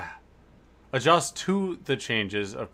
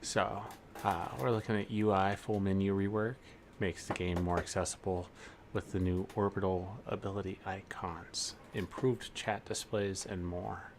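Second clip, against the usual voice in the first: right in your ear; 3 semitones lower, 9 dB quieter than usual. Pretend. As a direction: close to the mic, softer. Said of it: "get really close to the mic and whisper".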